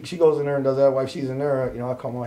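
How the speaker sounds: ordinary delivery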